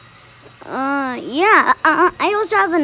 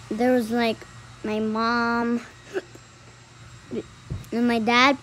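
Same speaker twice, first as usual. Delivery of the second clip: hiccups; 3 semitones lower, 6 dB quieter than usual. Real, not acted. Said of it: "I have the hiccups".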